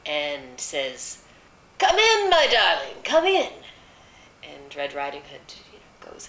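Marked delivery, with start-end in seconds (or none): 1.8-3.5 very high, loud put-on granny; fake grandmother voice. A narrator's reading. Wolf posing as grandmother calls the girl inside, fake grandmotherly. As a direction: call out in a fake, sing-song grandmother falsetto. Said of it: "puts on a fake grandmothery sort of voice".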